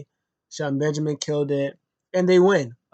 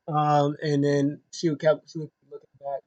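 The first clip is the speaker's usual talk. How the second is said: ordinary talk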